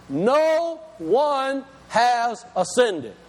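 very slow, drawn out with the weight on "No"; said slowly. Shown as a demonstration. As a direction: stretch "No one has ascended" word by word. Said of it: "I'm gonna say it slow".

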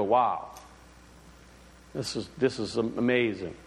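low, soft wonder at something amazing; imitating a listener struck with wonder; hushed awe. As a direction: breathe it out in quiet amazement. Acting out awe at creation.

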